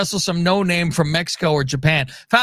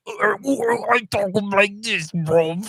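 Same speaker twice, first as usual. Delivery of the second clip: hammed-up toothy Cockney impression, higher than usual; Bruv; 4 semitones higher, slower than usual. Host shows the impression MJF couldn't do, mock Cockney. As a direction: talk as if big teeth are hanging out. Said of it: "he did the same goddamn impression".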